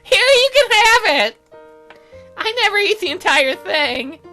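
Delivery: put-on very high, girly voice, loud at first; eager game girl. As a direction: offer it in a high-pitched girl voice. Voices Aseline offering her sandwich.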